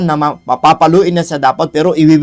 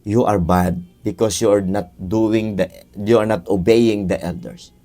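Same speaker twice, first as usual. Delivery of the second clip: low, slow, measured scolding; stern parent lecture; 6 semitones lower than usual. Acted. Father explaining the spank to his child, low and slow. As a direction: lay down the rules slowly like a firm father.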